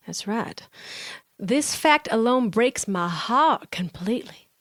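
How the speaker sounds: put-on Southern accent, vowels stretched; theatrical Southern drawl. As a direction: read the review like a Southerner. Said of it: "give us a nice Southern drawl".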